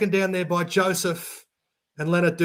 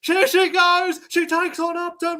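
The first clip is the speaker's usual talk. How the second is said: excited call; 11 semitones higher than usual. Real, not acted. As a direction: keep the thrill going. Breakaway run called live.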